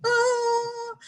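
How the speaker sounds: high straining ah sound; a sharp high cry as if willing life back; pleading cry. Retold or imitated. Mother straining to revive the bird, high pleading ah. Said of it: "and I'm like".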